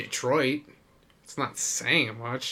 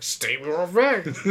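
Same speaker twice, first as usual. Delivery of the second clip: slow, drawn-out mock demand; teasing imitation of a demand; 5 semitones higher, slower than usual. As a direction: imitate a pushy heckler, higher and stretched out. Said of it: "You're just like".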